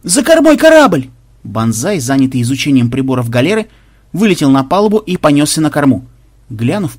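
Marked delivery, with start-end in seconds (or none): 0.0-1.1 high, loud lookout's cry; yelled alarm. A narrator's reading. Lookout warning of a ship astern, high loud yell. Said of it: "вдруг завопил кто-то".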